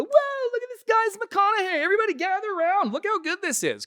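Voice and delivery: high, loud mock amazement; amazed party guests. Party guest calling everyone over, high excited mock amazement. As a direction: squeal it like an impressed crowd. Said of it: "for people to go".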